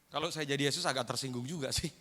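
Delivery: ordinary talk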